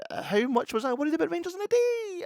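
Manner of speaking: hammed-up Welsh advert voice; mock Welsh. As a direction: parody the sponsor plug in his voice. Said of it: "I can't do the Welsh accent".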